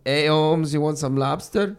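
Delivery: amateur Mexican homie accent; put-on Mexican accent. Shown as a demonstration. Showing friends the lobster seller on request, mock Mexican accent. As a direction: do an unconvincing Mexican street-vendor accent. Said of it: "One more time".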